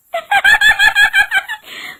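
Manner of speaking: very high, loud cackle; piercing, rapid witch cackle; witch's laugh. A narrator's reading. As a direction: shriek with laughter as you break free. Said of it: "चुड़ैल हंसते हुए बाहर निकलती है".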